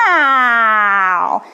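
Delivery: loud falling angry meow; angry cat meow. Shown as a demonstration. Teacher leading kids' warm-up, loud angry cat meow. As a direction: yowl like a cross cat. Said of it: "be an angry Halloween cat".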